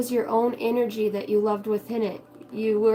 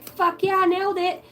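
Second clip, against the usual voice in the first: much higher mock cheer of triumph; triumphant; 9 semitones higher than usual. Soul back on the other side, high triumphant cheer. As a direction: celebrate like you just won. Soul celebrating after a life.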